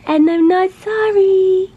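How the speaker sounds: high, slow sing-song. Real, not acted. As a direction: coo it defiantly. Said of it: "obnoxious baby voice".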